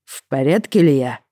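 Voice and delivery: put-on drunk, tongue-tied slurring; slurred speech. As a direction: slur the words like a drunk husband. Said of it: "заплетающимся языком спросил супруг".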